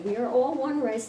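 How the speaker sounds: ordinary talk, nothing marked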